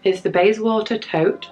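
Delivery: ordinary talk, nothing marked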